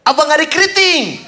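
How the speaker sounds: high-pitched club call-out; teriakan memanggil. Acted. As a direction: call out to a celebrity you spotted. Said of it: "ada yang teriak". (berteriak memanggil di kelab)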